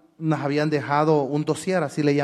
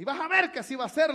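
bravata confiada; 10 semitones higher, 4 dB quieter than usual. Retold or imitated. Retold bluff to worried cousin.

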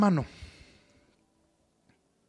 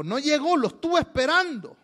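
higher-pitched mock complaint; acted reproach; 8 semitones higher than usual. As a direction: play someone put out after waiting in vain. Woman reproaches him for not coming.

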